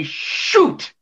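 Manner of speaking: mock sitcom "Shoot" up high; high, breathy mock "Shoot". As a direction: parody a frustrated sitcom character. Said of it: "they say".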